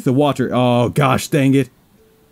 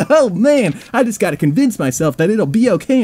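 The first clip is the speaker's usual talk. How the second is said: nervous laugh; 9 semitones higher than usual. Real, not acted. Nervous laugh before scary part.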